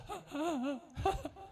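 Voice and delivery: high breathy burst of laughter; laughing. Retold or imitated. Preacher acting out his fit of laughter. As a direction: break into helpless laughter.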